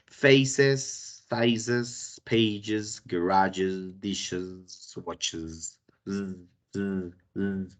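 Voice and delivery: teacher showing plurals ending in iz; iz plural demo. Shown as a demonstration. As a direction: model the iz ending on each word. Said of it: "la tercera pronunciación".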